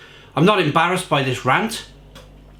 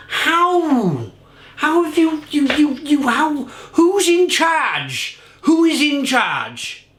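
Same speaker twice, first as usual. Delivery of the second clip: much higher, pitched-up disbelieving rant; exasperated outburst; 8 semitones higher, slower than usual. Real, not acted. Ranting at a brewery on camera, pitched-up exasperated outburst. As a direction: demand to know who's in charge, incredulous. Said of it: "Really angry".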